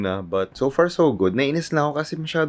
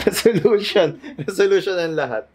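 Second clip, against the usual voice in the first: laughing; 9 semitones higher than usual. Real, not acted. Laughing about her resolution.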